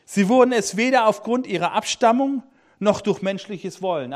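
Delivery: measured, solemn Bible reading; scripture reading. Acted. Preacher reads John to the church, solemn and measured. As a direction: read the Gospel aloud to the congregation.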